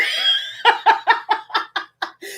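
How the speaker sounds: high, breathless cackle; long rolling laughter; uncontrollable laugh. Real, not acted. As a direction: laugh helplessly, unable to stop. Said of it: "I can't stop laughing".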